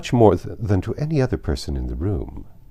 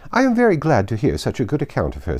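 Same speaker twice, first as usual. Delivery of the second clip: Lady Catherine; 6 semitones higher, 3 dB louder than usual. A narrator's reading.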